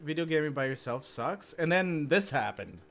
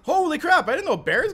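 shocked outburst; 12 semitones higher, 8 dB louder than usual. Real, not acted. Bear suddenly hits him.

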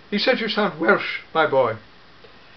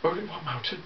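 ordinary delivery

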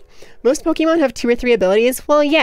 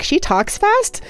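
mock surprised chat; 8 semitones higher, slower than usual. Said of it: "you all were like".

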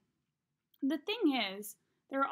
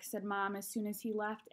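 ordinary delivery

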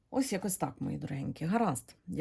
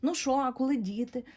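higher and louder, prying and provocative; teasing nosy questions; 7 semitones higher, 5 dB louder than usual. Retold or imitated. Fortune teller mimics nosy people asking about wedding and kids, teasing and prying. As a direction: poke at them like a nosy neighbour. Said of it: "задиркувато".

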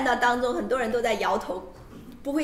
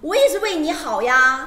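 loud scolding parent; nagging chinese mom; 4 semitones higher, 8 dB louder than usual. Retold or imitated. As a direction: bark it like a pushy mother. Interpreter voices well-meaning elders.

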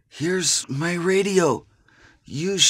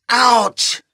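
pain cry; 4 semitones higher, 5 dB louder than usual. Acted. Wounded man cries in pain.

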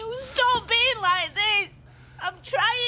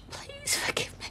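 higher, softer breathy begging; whispered plea; 9 semitones higher, 8 dB quieter than usual. Acted. Woman begging her partner for forgiveness. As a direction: beg in a whisper.

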